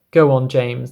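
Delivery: ordinary delivery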